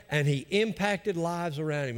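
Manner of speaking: usual speaking voice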